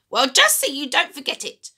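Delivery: put-on high, loud screechy old woman; screechy grandma voice. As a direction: nag him sharply from the next room. Grandma calls from next room.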